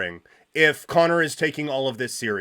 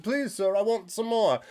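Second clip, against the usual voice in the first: soft, high British plea, higher and quieter; mock British beggar; 5 semitones higher, 4 dB quieter than usual. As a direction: beg in a meek British accent like Oliver Twist. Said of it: "only in a British accent".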